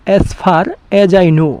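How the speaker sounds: very slow, careful English; slow model sentence. Shown as a demonstration. Teacher giving the English translation, slow and deliberate. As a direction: lay each word out slowly. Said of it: "এটার ইংলিশটা কি হবে?".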